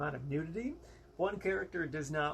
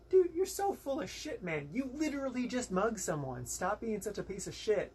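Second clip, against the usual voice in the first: the friend calling them out; 7 semitones higher than usual. Retold or imitated. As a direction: snap at him like the fed-up friend. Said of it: "was like".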